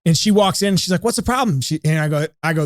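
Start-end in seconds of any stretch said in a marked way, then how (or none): 1.0-1.6 wife's voice. Retold or imitated. Sal voices his wife.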